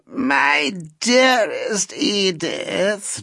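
put-on an old lady's affected drawl. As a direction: stretch every word like a faded society lady. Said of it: "drawled the lady in the chair".

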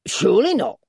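high, loud dwarf voice; high, sly swoop; dwarf's mock agreement. A narrator's reading. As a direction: agree with feigned innocence. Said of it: "returned the dwarf".

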